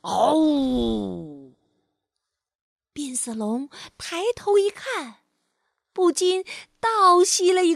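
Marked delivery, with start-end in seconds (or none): 0.0-1.6 low roar; deep lion roar. Acted. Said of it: "他就听到一声大吼".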